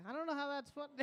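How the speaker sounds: usual speaking voice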